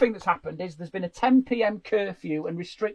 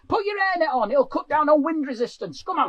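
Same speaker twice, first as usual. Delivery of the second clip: loud, high hectoring call; megaphone warden; 6 semitones higher, 5 dB louder than usual. Acted. Covid warden hurrying a pensioner before curfew, megaphone call. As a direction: holler at a dawdling pensioner. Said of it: "with a megaphone".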